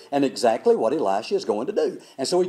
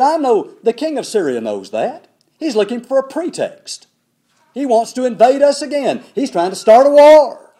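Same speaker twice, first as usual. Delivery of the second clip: upset king; 7 semitones higher, 6 dB louder than usual. Retold or imitated. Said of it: "he's in a tizzy".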